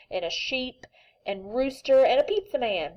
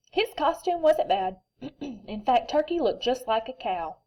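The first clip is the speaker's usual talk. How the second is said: ordinary delivery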